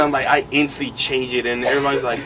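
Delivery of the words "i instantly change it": ordinary talk, nothing marked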